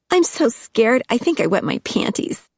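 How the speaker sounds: high, sarcastic fake fright; mock fear. Tina mocking Angus with fake fear, high. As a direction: pretend to be scared, mocking him.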